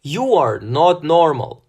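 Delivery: loud, high scream. A narrator's reading. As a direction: scream it at him. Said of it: "Sasha screamed".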